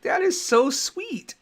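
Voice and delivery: high, slow, doting wife voice; mock-sappy wife coo, high and drawn out; wife gushing. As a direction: gush it like a touched wife. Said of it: "She's like".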